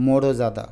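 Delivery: মোরজাদা as mo-ro-ja-da, vowel after র; wrong extra vowel. Shown as a demonstration. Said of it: "তা নাহলে উচ্চারণ হয়ে যাবে".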